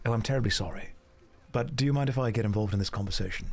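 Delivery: mock-charming posh Englishman chatting up ladies; a hint of a plummy English gent; posh English accent. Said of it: "They like the Hugh Grant English accent".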